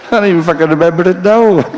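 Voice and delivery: high, giggly disbelief; laughing. Real, not acted. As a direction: squeak it out mid-laugh. Laughing at forgotten gag.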